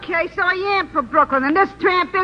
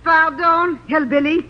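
put-on phony French accent; 2 semitones lower than usual. Actress in her fake French accent greeting the studio boss. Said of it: "that phony French voice".

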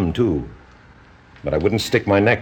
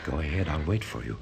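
soft hypnotic murmur; 6 semitones lower, 13 dB quieter, faster than usual. Acted. Doctor soothing her into trance.

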